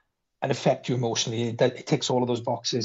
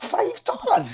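incredulous; slower than usual. Retold or imitated. Retold incredulous reply.

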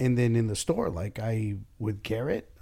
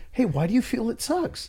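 much higher, syrupy curious question; mock friendly; 12 semitones higher than usual. Boss models defusing a complaint, syrupy curious question. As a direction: play the overly nice listener. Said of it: "If I say".

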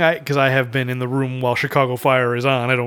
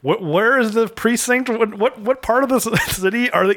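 mock confusion; 9 semitones higher than usual. Mocking confused viewer.

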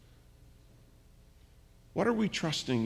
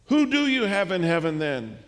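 raised, pleading call upward; a desperate shout at the gates; desperate cry; 5 semitones higher, 4 dB louder than usual. Retold or imitated. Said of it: "Whitefield cries out".

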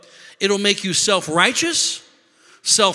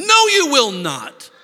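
louder, slower, emphatic refusal; firm rebuke; 7 semitones higher, 7 dB louder, slower than usual. Shown as a demonstration. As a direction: command it against temptation. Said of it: "we scream at ourselves saying".

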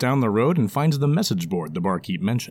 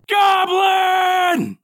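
high frantic shout of alarm; wailing shout; 5 dB louder than usual. Acted. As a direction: scream the word Goblin in fright. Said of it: "a patron wails".

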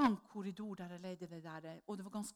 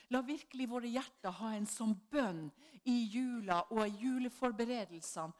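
usual speaking voice

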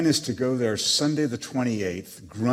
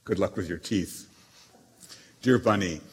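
normal talking voice

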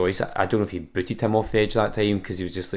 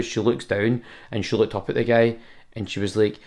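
ordinary talk

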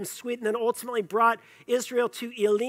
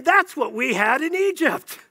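higher, louder insistent exclamation; mock nostalgic excitement; 4 semitones higher, 5 dB louder than usual. Israelites looking back on Egypt, mock excited. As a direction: play someone raving about the good old days.

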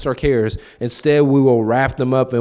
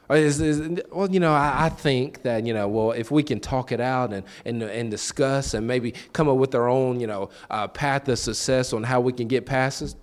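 usual speaking voice

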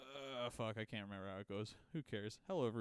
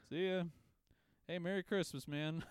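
cheery goodbye; 7 semitones higher, 4 dB louder than usual. Retold or imitated. Cheery goodbye to the keys' owner.

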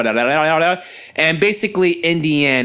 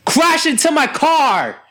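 retold angry comeback; 7 semitones higher than usual. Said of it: "I'm looking at this guy's like".

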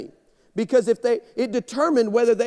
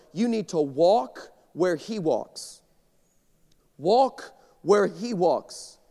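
slow emphatic; 3 semitones lower, much slower than usual. Acted. Pastor giving the congregation his first point, very slow. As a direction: lay out each word slowly.

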